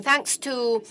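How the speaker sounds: usual speaking voice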